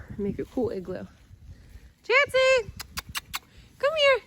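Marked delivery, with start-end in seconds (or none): 2.0-4.2 calling out. Real, not acted. Calling the dog outside.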